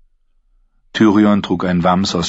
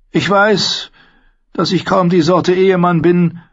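higher, earnest courtly apology; apologetic Tyrion; 11 semitones higher, 3 dB louder than usual. A narrator's reading. Tyrion to Sansa, sincerely apologising. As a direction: make amends sincerely. Tyrion admits his flaws.

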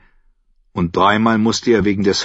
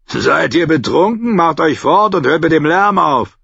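higher, louder, dismissive guard voice; gruff guard; 8 semitones higher, 4 dB louder than usual. Acted. Guard dismisses the knight at the gate. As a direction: brush off the drunk.

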